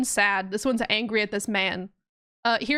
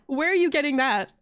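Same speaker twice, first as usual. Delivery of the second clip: ordinary talk, nothing marked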